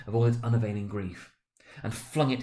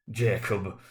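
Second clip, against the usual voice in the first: usual speaking voice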